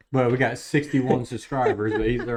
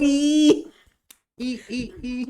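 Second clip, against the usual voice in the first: high mock cheer; 13 semitones higher, 4 dB louder, much slower than usual.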